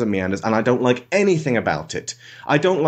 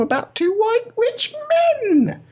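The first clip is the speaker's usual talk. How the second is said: mock it with a high sneer; sarcastic squeak. Real, not acted. Jabbing at a film about rich men, high sarcastic squeak. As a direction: voice shoots way up on the jab.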